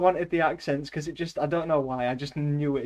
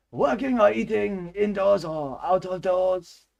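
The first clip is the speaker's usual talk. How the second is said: high-pitched, exaggerated French accent; comic Frenchman reading aloud; 4 semitones higher, 4 dB louder, slower than usual. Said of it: "That is how a Frenchman would say it".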